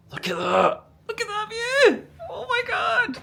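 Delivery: choked up, pitch shooting high, half crying; tearful joy. Real, not acted. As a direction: voice breaking into happy tears at the view. Overwhelmed on seeing the view.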